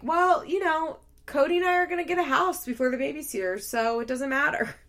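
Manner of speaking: breezy mimicry. Rachel dismissing Stephanie's worries, mock breezy. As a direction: imitate someone airily brushing off advice.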